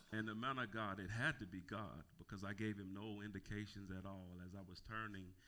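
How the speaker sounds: usual speaking voice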